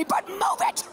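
high, barked order; hammed-up shout to clear the way; scream at people. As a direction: snap from apology into a yell. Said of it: "as I scream at people".

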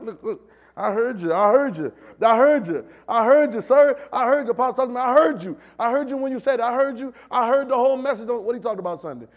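loud insistent I heard you, rising high; excited shouting. Acted. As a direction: proclaim it like an eager churchgoer shouting back. Preacher acting eager churchgoer.

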